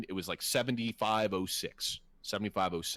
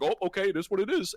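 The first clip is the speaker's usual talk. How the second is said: higher, lightly voiced shrugging boss; mocking the boss; 11 semitones higher, 3 dB louder, faster than usual. Mocking his boss conceding to insurers, a higher shrugging voice. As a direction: lightly play the spineless boss giving up. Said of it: "He's just like".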